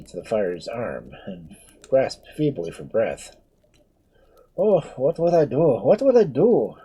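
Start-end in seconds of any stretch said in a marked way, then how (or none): 4.6-6.7 raised, higher despairing repeat; looking round for aid, lost; helpless panic. Acted. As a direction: priest desperate as the girl faints. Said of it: "repeated the poor father".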